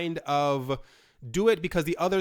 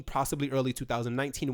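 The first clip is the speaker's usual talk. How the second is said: ordinary talk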